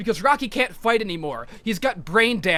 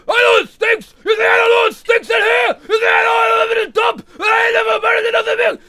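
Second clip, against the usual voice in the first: furious shout, much higher and louder; pissed-off yell; 12 semitones higher, 8 dB louder than usual. Retold or imitated. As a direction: bellow it like a cornered, frustrated fighter. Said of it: "he's just getting pissed off".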